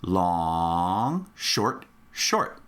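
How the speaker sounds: LONG stretched low, each SHORT brief; rhythm demo. Shown as a demonstration. Teacher modelling the rhythm for students, long held out, shorts brief. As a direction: hold "long", then two quick "short"s. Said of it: "the word long has to be longer".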